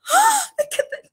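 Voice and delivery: amazed cry. Retold or imitated. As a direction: gasp it out like the family seeing a miracle. Family amazed at found earring.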